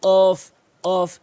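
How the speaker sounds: কফ hit loud from the tongue root; pushed qaf. Shown as a demonstration. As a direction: thrust the কফ out forcefully. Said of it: "ধাক্কা দিয়ে কিন্তু কফ". (ধাক্কা দিয়ে জোরে কফ)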